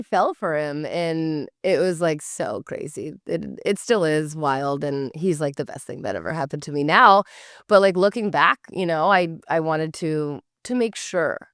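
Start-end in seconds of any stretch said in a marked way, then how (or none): none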